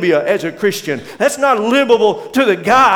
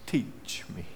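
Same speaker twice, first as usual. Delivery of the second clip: breathy hushed whisper of a humble plea; 9 semitones lower, 17 dB quieter than usual. Acted. Moses asking God, whispered humbly. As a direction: whisper it like a prayer.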